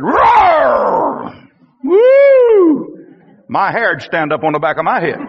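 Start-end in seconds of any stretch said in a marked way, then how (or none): 0.0-1.3 imitated lion's roar, falling away; mock roar, high start sliding down. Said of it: "lion come walking through the back door and said". 1.8-3.0 high, loud "woo"; big hammed-up fright whoop; mock startled whoop. Startled reaction to imagined lion.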